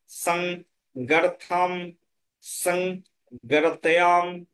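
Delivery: সংজ্ঞার্থম drawn out slowly, সং then জ্ঞার্থম; slow syllable drill. Shown as a demonstration. Teacher drills a hard conjunct for students, slowly. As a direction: stretch each syllable of সংজ্ঞার্থম. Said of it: "তাহলে সং দন্ত সা".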